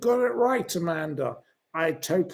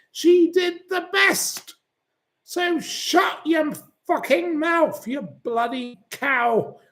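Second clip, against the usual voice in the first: much higher, slow furious scolding; angry rant; 8 semitones higher than usual. Real, not acted. Host berates a commenter, high slow furious rant. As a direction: tear into the commenter.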